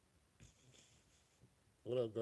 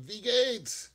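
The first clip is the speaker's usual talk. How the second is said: high, loud, proud Wie geht's; bright tourist showing off a phrase; eager German greeting; 11 semitones higher, 10 dB louder than usual. Retold or imitated. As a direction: show off your German, much higher. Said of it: "I was like".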